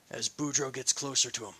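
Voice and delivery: plain reading voice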